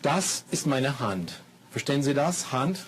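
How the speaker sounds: slow, exaggerated words for learners; careful classroom German, slowed down; slow teacher talk. Shown as a demonstration. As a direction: spell it out slowly for beginners. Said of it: "Here's lesson number two".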